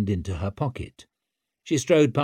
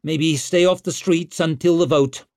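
loud, higher-pitched advice; raised-voice warning; 5 semitones higher, 4 dB louder than usual. A narrator's reading. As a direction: call out a brisk warning. Rescuer warns the man she saved.

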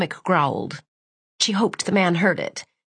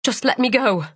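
frightened plea; 4 semitones higher than usual. Acted. Captive begs.

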